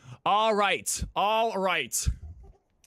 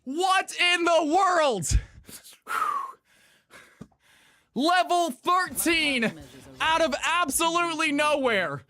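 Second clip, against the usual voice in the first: slow, higher "What in the world"; drawn-out disbelief; 6 semitones higher than usual. Real, not acted. Streamer stunned by a surprise pick, slow high disbelief. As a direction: stretch every word in amazement.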